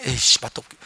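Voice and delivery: low, soft disgusted grumble; mild mock annoyance, muttered low; grumpy mutter. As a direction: sulk about the soup under your breath. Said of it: "어?".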